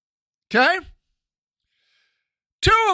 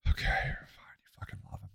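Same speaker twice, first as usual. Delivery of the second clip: resigned whisper, much softer; whispered aside; 16 dB quieter than usual. Real, not acted. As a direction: whisper it in defeat. Gives up, muttering aside.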